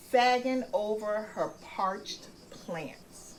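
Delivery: slow, steady storybook voice; story narration. A narrator's reading. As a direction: read it unhurried for a child. Parent reads the story.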